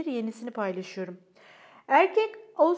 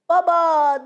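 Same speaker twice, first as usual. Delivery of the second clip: loud call of Dad, falling; child shouting at dad during a game; 4 semitones higher, 11 dB louder than usual. A narrator's reading. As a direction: yell across the field at him. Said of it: "diye bağırdı Francesca".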